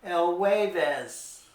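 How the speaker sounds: "jueves" said "WEH-ves", J like English W; Spanish J as W. Shown as a demonstration. Teacher showing kids the W sound in jueves. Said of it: "J U sounds like a W in Spanish".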